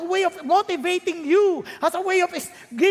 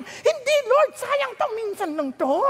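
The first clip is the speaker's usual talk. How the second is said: begging believer; 8 semitones higher than usual. Acted. Believer begging God not to waste chance.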